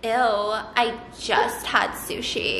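put-on slow, drawn-out teen-girl disgust; Mean Girls-era teen. Friend turning down sister's idea, slow mock teen-girl disdain. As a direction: imitate a cool girl dragging out her objection. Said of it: "This is, like, how everybody talked back then".

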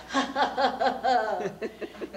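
loud, staccato villain cackle; hammed-up evil "ha ha ha"; evil laugh. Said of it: "Gordon laughed his evil laugh".

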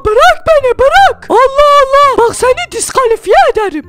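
high furious yells to let go; angry shout. Acted. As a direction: scream to be released. Host pulled by fishing rod.

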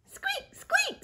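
very high mouse squeak; squeaky mouse. Acted. Teacher voicing Mouse, very high squeak. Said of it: "said Mouse".

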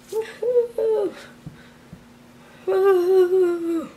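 cheering. Real, not acted. Celebrating a finished all-nighter.

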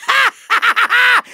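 high, scornful villain cackle; intolerant laugh. Acted. Villain laughing at stupid cheaters, scornful cackle. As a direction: laugh with theatrical contempt. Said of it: "I'm laughing, but it's a laugh of intolerance".

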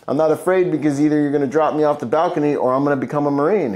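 loud, high and fast defiant answer; defiant retort. Retold or imitated. The teen refusing to back down to his father, loud and fast. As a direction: fire back at the dad.

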